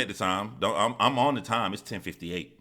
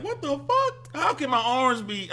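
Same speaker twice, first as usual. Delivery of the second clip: stunned disbelief; slower than usual. Retold or imitated. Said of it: "I was like".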